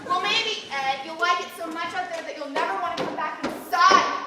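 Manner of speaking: loud, pointed sarcasm; irritated retort. Acted. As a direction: throw it at your husband, fed up. Wife snaps back at husband.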